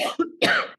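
sudden harsh coughing; coughing fit. Real, not acted. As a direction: cough as if something went down wrong. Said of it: "Sorry, I'm choking to death".

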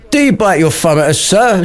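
quick, high-pitched theatrical challenge; mock shouting. Abraham repeating his challenge to Samson, high mock shout. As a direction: rattle it out, leaping up on SIR. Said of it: "shouted Abraham once again".

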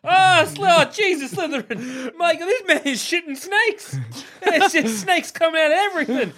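high, hammed-up horror with laughter; frantic comic wailing; panicked hat. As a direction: cry out high in mock panic. Acts Sorting Hat screaming about snakes.

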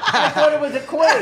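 high, laughing reply. Real, not acted. Teasing partner about his joke, laughing. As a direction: giggle the excuse out.

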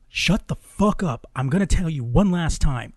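loud, emphatic retold threat; stern final warning. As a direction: act out a man losing patience. Boyfriend gives her final warning.

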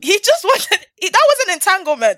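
loud, high-pitched indignant outburst; real exasperated ranting, loud and high; outraged rant. Real, not acted. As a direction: fire off the outrage, voice climbing high. Heated rant about cheating husband.

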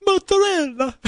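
high, hammed-up Italian mozzarella; Italian accent. Shown as a demonstration. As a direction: sing it out like a cartoon Italian. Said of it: "How do you say it?".

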